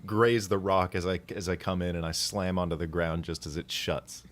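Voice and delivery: normal talking voice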